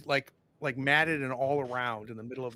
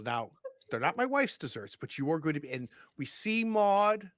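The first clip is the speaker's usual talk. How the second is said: ordinary delivery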